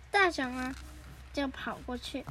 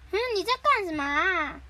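high, louder, exclaiming question; mock dismay; 5 semitones higher, 5 dB louder than usual. Doraemon runs over to Nobita, dismayed question. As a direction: ask it high with cartoon alarm.

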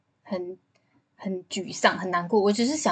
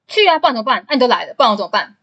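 louder, fast mock grumble of a boyfriend forced out; grudging angry retort; 2 semitones higher, 8 dB louder, faster than usual. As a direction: imitate him giving in with an irritated snap. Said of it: "就这么凶欸". (模仿男友不情愿地大声抱怨)

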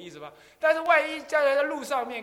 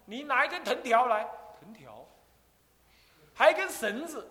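高声下令，语气坚决; loud, firm orders to a disciple; stern command; 5 semitones higher, 7 dB louder than usual. Retold or imitated. 禅师命令弟子拿藤条绳子. (禅师大声吩咐弟子拿藤条)

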